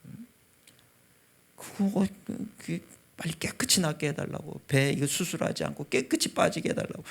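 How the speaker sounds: retold breathy, strained plea to God; tearful pleading prayer. Father begging God outside the operating room, tearful. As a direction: re-enact a desperate prayer, voice thinning at the end. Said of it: "눈물을 펑펑 흘리면서 기도를 하는".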